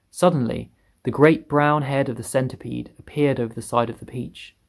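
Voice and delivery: ordinary delivery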